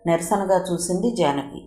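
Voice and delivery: ordinary delivery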